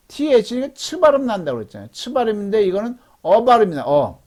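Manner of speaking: ordinary delivery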